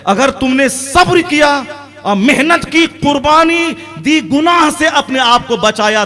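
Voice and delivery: high-pitched rousing exhortation; impassioned preaching. Real, not acted. Preaches at full force. (जोशीली, ऊँची आवाज़ में नसीहत)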